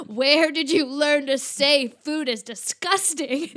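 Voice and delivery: loud, slow parental telling-off; mock scolding. Mom to child who called carrots disgusting, mock scolding. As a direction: drag out each word in hammed-up outrage.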